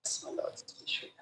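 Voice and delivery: a real whisper while leafing through papers; whispered aside. Real, not acted. Counsel murmurs to the bench while searching papers, whispering. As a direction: breathe the words, no voice at all.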